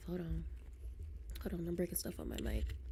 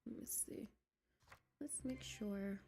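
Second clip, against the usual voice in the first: whispering; 13 dB quieter than usual. Real, not acted. Streamer whispers to herself while checking settings. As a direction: whisper carefully as if not to disturb.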